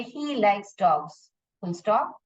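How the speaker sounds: slow sentence, then a clear stop; pause at full stop. Shown as a demonstration. Teacher showing pupils the pause at a full stop. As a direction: stop fully after dogs. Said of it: "एक pause डालोगे".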